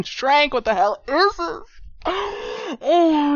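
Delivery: high, loud freak-out with a gasp; yelling. Acted. Man babbling at shrunken friend, yelling. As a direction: cry out in overwhelmed disbelief. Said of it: "I'm sorry I yelled".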